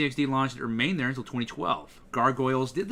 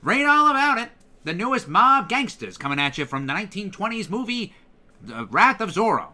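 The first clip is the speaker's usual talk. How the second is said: newsboy shouting headlines, higher and louder; 1920s announcer; 7 semitones higher, 6 dB louder than usual. Shown as a demonstration. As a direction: hawk the headline like an old-time announcer. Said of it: "go to a nineteen twenties announcer".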